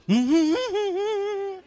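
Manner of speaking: long quavering squeal; little girl's acted whimper; high-pitched wordless whimper. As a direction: answer with a high trembling hum. Frightened girl's wordless answer.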